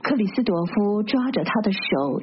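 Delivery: usual speaking voice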